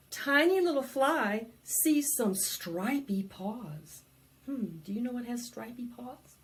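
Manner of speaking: ordinary delivery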